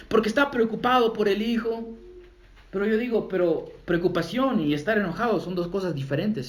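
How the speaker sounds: ordinary talk, nothing marked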